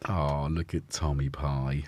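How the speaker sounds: normal talking voice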